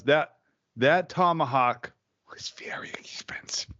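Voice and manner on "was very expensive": hushed whisper about the price; breathy confession of the bill; whispered aside. Real, not acted. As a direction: whisper it like a guilty secret.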